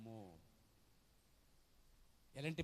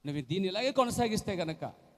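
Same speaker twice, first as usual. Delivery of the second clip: loud, higher prophet's warning; stern rebuke; 8 semitones higher, 7 dB louder than usual. Retold or imitated. Prophet rebuking the hiding wife, loud. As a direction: rebuke her with force.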